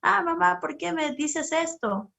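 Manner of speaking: put-on a child protesting to mama, hurt and sulky; child's whiny complaint. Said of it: "se levanta nuestra queja".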